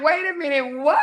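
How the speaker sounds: high, laughing disbelief; giggly shocked what; stunned wait a minute. Retold or imitated. Reacting to husband's prank: high laughing disbelief. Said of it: "I was like".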